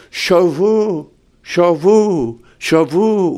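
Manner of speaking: Shavu said high and ringing, like a prophet calling out; prophet's call. Retold or imitated. Pastor voicing Hebrew prophets calling the people, high call. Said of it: "the Hebrew prophets would say".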